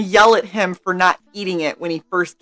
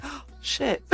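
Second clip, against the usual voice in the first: breathless whispered dismay; whispered oh shit; 5 semitones higher, 16 dB quieter than usual. Retold or imitated. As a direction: whisper it, caught out. Caught, picked by host.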